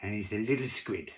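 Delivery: high, loud French waiter, then dropping; reluctant admission in a French accent. Acted. Waiter confesses to diner about the tank, French accent. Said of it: "I can't do a French accent".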